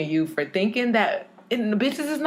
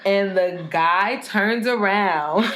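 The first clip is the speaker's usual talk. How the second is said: usual speaking voice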